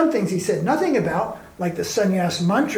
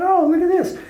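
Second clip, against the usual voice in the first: mock surprise; 9 semitones higher, 4 dB louder than usual. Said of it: "They went".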